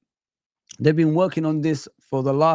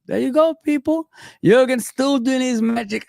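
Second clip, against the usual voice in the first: excited; 8 semitones higher than usual. Real, not acted. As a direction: hype up the viewers. Hyped reaction to transfer news.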